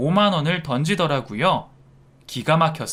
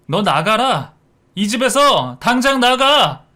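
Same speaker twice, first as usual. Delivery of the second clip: loud, higher-pitched outburst; furious order; 6 semitones higher, 6 dB louder than usual. Acted. Son ordering his wife out of the house, furious. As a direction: throw her out, louder on the second command. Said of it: "며느리를 밀어내더라고요".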